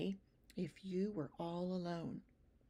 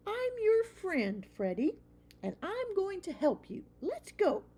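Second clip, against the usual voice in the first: put-on high, earnest young mouse voice; a small child mouse pleading; little mouse boy; 12 semitones higher, 4 dB louder than usual. A narrator's reading. Young mouse tells his mother, high child voice. Christopher insists on helping.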